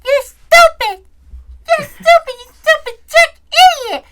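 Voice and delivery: high shrieking kid voice mocking a teen; little kid taunting. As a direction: squeal it like a bratty five-year-old. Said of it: "a little five-year-old going".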